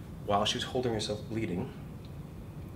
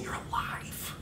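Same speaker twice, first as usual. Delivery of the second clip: softer, hushed whisper; joy voice whisper; 7 dB quieter, slower than usual. Acted. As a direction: breathe the words in wonder. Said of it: "So the joy voice is saying".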